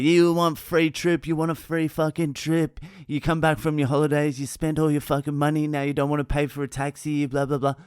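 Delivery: high-pitched imitation of an irate driver; unleashed mock rant. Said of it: "this guy just unleashed".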